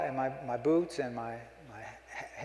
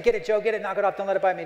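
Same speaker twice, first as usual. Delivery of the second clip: higher, louder frantic plea; panicked yell; 5 semitones higher, 7 dB louder, faster than usual. Retold or imitated. Me yelling at my buddy to swat the bug, panicked. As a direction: yell as if a bug's about to bite. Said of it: "I'm saying".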